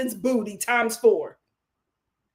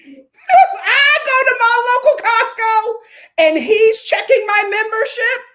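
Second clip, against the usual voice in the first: laughing; 10 semitones higher, 8 dB louder than usual. Real, not acted. Laughing loud scenario.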